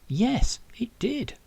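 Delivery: high, bright burst of yes; bursting to share good news; excited. A narrator's reading. Ria confirming to Zack that he time travelled, excited and high. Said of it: "with an excited tone in her voice".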